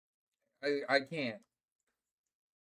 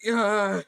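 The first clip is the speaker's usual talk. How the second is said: loud, high groan of pain; 5 semitones higher, 7 dB louder than usual. Real, not acted. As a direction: let out a long pained moan.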